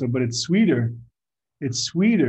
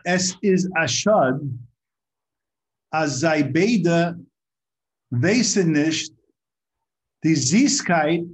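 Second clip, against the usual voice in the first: slow, deliberate Yiddish quotation; weighty word-by-word delivery, slightly raised voice; very slow recitation; 3 semitones higher, 4 dB louder, slower than usual. Real, not acted. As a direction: recite it slowly and firmly, with pauses between phrases. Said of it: "say it over very slowly".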